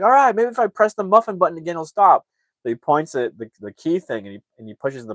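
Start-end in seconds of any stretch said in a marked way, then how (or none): 0.0-2.3 high, loud and fast hopeful pitch; frantic hope. Retold or imitated. Dad pressing the muffin button again, frantic high voice. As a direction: rush it out like a dad grasping at a fix.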